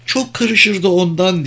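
high-pitched, lightly amused answer. A narrator's reading. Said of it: "diye güldü". (hafif gülerek, tiz sesle)

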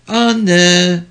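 put-on high, loud frightened child's cry. A narrator's reading. Child wakes in the car and calls mother, frightened. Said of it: "sesi korku doluydu".

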